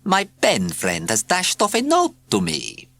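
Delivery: high, dreamy purr; dreamy cat voice. A narrator's reading. Cat coos to herself over her letter. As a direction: sound pleased and dreamy. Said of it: "she sighed".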